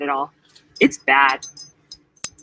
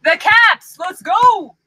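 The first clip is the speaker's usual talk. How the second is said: high, loud cheer for the Caps; rally the team like a hyped fan; excited cheer; 10 semitones higher, 5 dB louder, much slower than usual. Real, not acted. Hockey fan cheering her team to win, excited shout.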